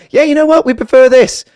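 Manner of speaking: high, loud put-on voice of the mates; hammed-up loud chorus of approving friends; mates' reply. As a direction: pitch it way up and push it out loud.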